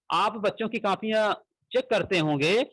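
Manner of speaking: ordinary talk, nothing marked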